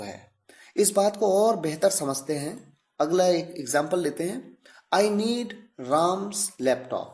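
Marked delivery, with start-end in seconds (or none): 4.9-7.1 very slow, each word spaced out; slow, deliberate example. Shown as a demonstration. Teacher reading an English example very slowly. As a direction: spell it out word by word for the students. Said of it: "यानी मुझे राम का laptop चाहिए".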